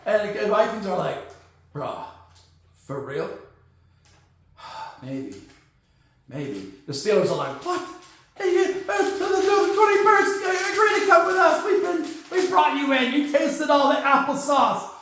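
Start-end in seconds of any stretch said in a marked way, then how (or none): 1.7-6.7 low, hushed, hesitant murmur; low, soft, reluctant maybe; quietly tempted. Acted. Acts hesitant Vikings reply. 7.7-14.9 high, flustered sputtering protest; jilted team's worked-up complaint; indignant outburst. Acted. Acts out indignant Steelers.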